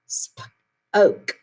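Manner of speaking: Sp-oak split, long oak drawn out; segmented word. Shown as a demonstration. Teacher splits spoke to show the long O. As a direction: break Sp-oak into onset and long O. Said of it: "makes the O say its long sound".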